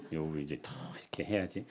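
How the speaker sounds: a quick whispered aside. Real, not acted. The pastor whispers a joking aside to the congregation. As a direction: drop to a whisper as if sharing a secret.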